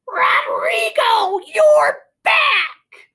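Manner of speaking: very high, loud, stretched call; old lady calling. Acted. Old lady greeting her lost moose, high and loud. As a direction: cry out with delight like a sweet old lady. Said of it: "This lady says".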